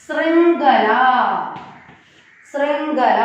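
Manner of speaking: ശൃംഖല syllable by syllable, last vowel held; model pronunciation. Shown as a demonstration. Teacher models ശൃംഖല for the class, careful and drawn out. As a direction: repeat ശൃംഖല carefully with a long pause between. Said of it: "correct pronunciation".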